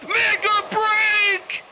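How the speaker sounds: slow, drawn-out high yell; excited shout. Real, not acted. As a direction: stretch every word out in a big shout. Climax of the match.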